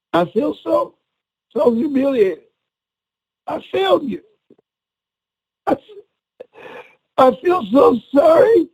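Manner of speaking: high, halting voice near tears; crying to Mama. Retold or imitated. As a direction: re-enact a tearful confession, pausing between phrases. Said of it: "So I was crying".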